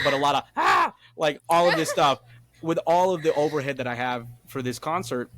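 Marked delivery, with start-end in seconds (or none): 0.5-0.9 high, loud "ah" yelp; hammed-up cry of alarm; mock panicked scream. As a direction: act out a burst of panic. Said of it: "So I'm doing that".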